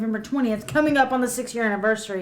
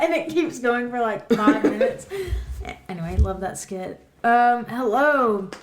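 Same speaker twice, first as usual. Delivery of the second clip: ordinary talk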